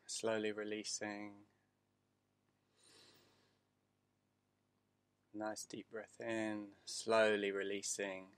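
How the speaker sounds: calm, unhurried meditation voice; guided meditation. Acted. Teacher guiding listeners through breathing, meditation voice. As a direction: ease them into the breath.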